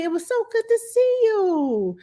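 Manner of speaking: high, gushing delight; thrilled to see her; delighted greeting. Real, not acted. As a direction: squeal with joy at a friend. Greeting returning friend.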